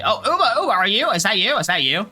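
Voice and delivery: high, loud surprised greeting; sudden relief at finding her; surprised call. Pretend. Finds Uma by the well.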